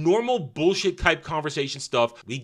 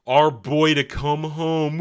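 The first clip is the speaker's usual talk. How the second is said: slow whiny fan voice; 3 semitones higher, 6 dB louder, slower than usual. Retold or imitated.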